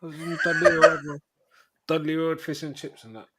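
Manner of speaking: put-on playful Birmingham accent; Brom accent. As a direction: lay on a Brummie accent for Dudley Road. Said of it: "my Scouse accent or my Brom accent".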